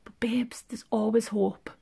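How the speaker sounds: very soft, dropping into a whisper; hushed, slowing whisper of hope; hushed reassurance. Acted. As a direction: whisper it like a secret comfort. Mock-tender reassurance to partner.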